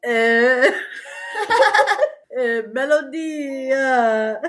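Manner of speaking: laughing too hard to answer. Real, not acted. Laughs before answering question.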